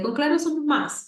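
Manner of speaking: put-on mild child voice; imitating a small child. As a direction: echo the phrase like a little boy. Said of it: "había un pequeño que decía".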